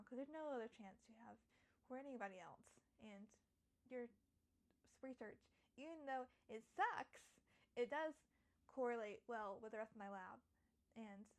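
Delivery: ordinary talk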